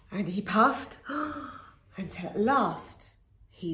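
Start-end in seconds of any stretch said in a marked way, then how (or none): 1.0-1.6 wolf huffing breath. Acted. Wolf blowing.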